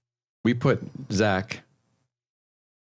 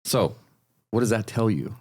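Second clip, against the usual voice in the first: ordinary talk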